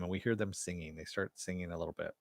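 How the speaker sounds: normal talking voice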